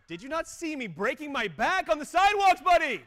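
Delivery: high, loud mock outrage at the buddy; sarcastic snap. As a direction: bite back like a put-upon sweeper. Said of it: "And I was like".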